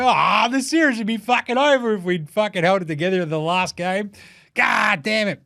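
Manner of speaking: hammed-up sore loser moaning about the last game; mocking Dallas fan. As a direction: whine like a bitter Dallas fan. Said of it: "'Cause Dallas fans".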